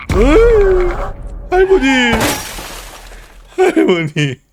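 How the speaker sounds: high frightened shout; startled scream. Real, not acted. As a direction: shriek in fright. Scared by grandma ghost.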